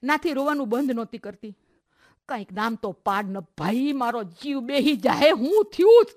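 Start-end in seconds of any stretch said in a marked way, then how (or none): 2.3-6.1 frantic pleading. A narrator's reading. Aunt shaking a crying girl for answers, frantic. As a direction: build to a desperate, raised question. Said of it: "મોંઘીભાઈ નાથીને હલબલાવતા હતા".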